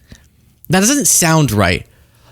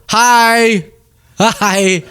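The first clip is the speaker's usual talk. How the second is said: careful greeting demo; 8 semitones higher than usual. Shown as a demonstration. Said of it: "I go".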